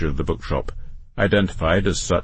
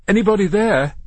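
high, tentative call; 11 semitones higher, 3 dB louder than usual. A narrator's reading. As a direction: call out to whoever might be there. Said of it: "he cried out".

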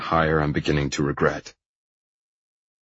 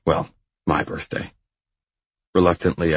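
ordinary delivery, nothing marked

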